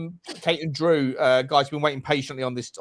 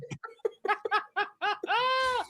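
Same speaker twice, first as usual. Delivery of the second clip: squeaky laugh; 7 dB quieter than usual. Real, not acted. High laugh after baby talk.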